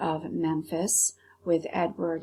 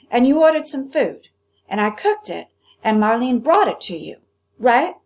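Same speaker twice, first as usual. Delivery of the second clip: loud, higher, hammering each clause; angry cook grills the boy over his bill; 5 semitones higher, 9 dB louder than usual. Acted. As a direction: build the case like an accusation.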